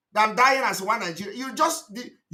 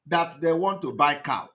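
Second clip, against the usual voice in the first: ordinary talk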